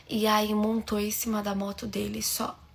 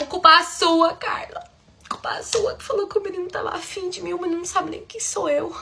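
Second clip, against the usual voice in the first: mock blaming; 6 semitones higher, 3 dB louder than usual. Blaming her friend.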